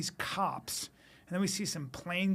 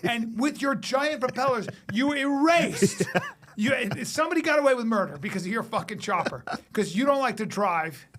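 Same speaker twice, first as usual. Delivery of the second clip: higher, louder, fed-up detective ranting; exasperated cop; 6 semitones higher, 6 dB louder than usual. Retold or imitated. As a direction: rant like a furious detective whose scene got wrecked. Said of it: "the guy goes".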